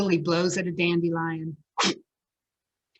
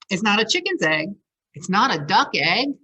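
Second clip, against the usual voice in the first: ordinary delivery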